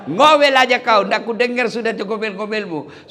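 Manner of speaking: husband yelling down the well at his wife. Retold or imitated. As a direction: shout it down the well. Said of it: "Dia bilang suaminya".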